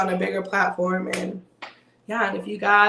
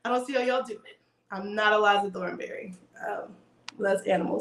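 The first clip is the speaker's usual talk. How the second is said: normal talking voice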